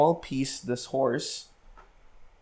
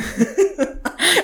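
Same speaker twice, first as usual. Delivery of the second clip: high burst of laughter; a real laugh, pitched up. Real, not acted. Son laughs recalling his mom's look, real high laugh.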